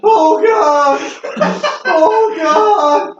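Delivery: high, loud panicked shriek. Acted. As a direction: shriek it in terror. NPC sees the blood.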